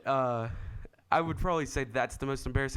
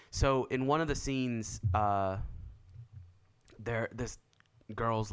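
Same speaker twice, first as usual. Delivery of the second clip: ordinary delivery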